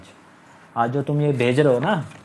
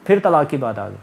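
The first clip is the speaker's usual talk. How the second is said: ordinary talk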